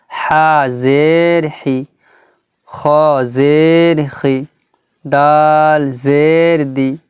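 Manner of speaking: slow, sustained chant of letter names; measured Quran-primer spelling drill; letter-drill recitation. Shown as a demonstration. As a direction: hold each letter name long, end on a short syllable.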